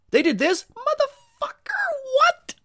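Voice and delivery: very high, breaking into a whispered squeal; over-the-top scandalized gossip; squeaky mock gossip. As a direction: gasp the words out in a squeaky whisper.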